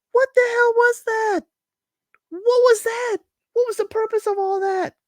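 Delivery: high, squeaky mock disbelief; stunned disbelief. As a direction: gasp it out in shock, way up high. Both stunned after the visit.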